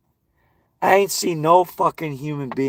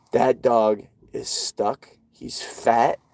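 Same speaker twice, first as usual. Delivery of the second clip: ordinary talk, nothing marked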